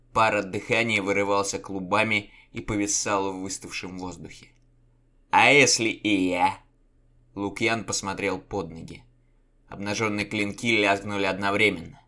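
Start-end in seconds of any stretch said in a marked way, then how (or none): 5.3-6.6 defiant challenge. A narrator's reading. Challenge before swords drawn.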